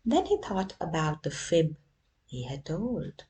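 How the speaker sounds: ordinary delivery, nothing marked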